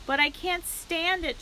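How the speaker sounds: high-pitched, mildly shouted protest; distressed shout. A narrator's reading. Pig protesting to his dying friend, a mild shout. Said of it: "shouted Wilbur".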